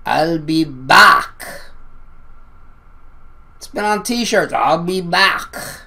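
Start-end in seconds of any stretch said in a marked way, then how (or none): 0.0-1.6 put-on slow, heavy Austrian accent, loud; mock Austrian Terminator growl; Arnold Schwarzenegger accent. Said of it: "his heavy accent saying". 4.5-5.8 put-on slow, thick Austrian accent; imitating the heavy Austrian Terminator line; Schwarzenegger accent. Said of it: "his heavy accent saying".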